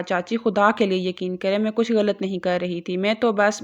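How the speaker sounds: ordinary delivery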